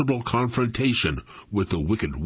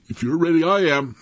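breathless, louder; out of breath; 6 semitones higher, 4 dB louder than usual. A narrator's reading. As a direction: push words out winded. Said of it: "almost out of breath".

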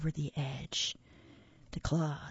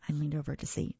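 plain reading voice

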